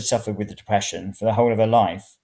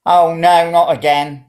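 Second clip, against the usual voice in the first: loud, high groan of exasperation; old lady fed up with the rain; exasperated old woman; 7 semitones higher, 10 dB louder, slower than usual. A narrator's reading. Old woman sees the rain start again, loud and exasperated. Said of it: "the old woman said".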